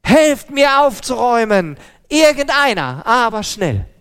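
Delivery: high, loud hurried plea; urgent call. A narrator's reading. Woman calling friends to help her clean, urgent and loud. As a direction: urge them to hurry and help. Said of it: "rief sie".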